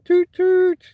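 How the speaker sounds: high, loud toot like a little steam engine; mock train whistle. Streamer mimics Ivor the Engine's whistle, high playful toot. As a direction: squeal it out like a toy train whistle.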